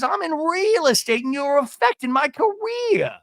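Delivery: mock livid, very high voice; Gina. Imitating a livid housewife complaining about her career, high mock outrage. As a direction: whine it way up high in hammed-up outrage. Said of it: "Gina was livid because".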